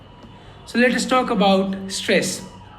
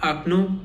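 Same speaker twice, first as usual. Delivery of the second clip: "akno" with its weight on the final O; stress on last O. Shown as a demonstration. Teacher showing how window sounds, last O stressed in "akno". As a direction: stress the second syllable of "akno". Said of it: "only the last O is stressed".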